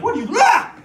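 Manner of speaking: sudden high squeal of fake shock; hammed-up surprised shriek; mock startled yelp. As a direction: yelp it like you just found out you're tall. Mock startled reply to tall remark.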